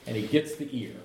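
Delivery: usual speaking voice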